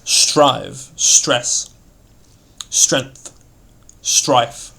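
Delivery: STRIVE, STRESS, STRENGTH, STRIFE each driven out with force; separate, energetic word stresses; forceful energy. Shown as a demonstration. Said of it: "indicates violent force or energy".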